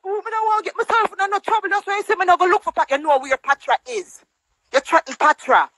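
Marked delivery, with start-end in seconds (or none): 0.0-3.0 high, fast mocking whine. Vlogger mocks the old woman's excuse to viewers, high sneering whine. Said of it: "And then I ball out".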